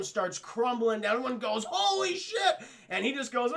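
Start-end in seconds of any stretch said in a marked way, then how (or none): 1.7-2.6 high, loud panicked cry; mock scream. Crowd as ceiling crumbles, high mock scream. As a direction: yelp as if the roof falls.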